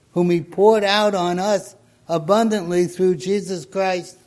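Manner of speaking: usual speaking voice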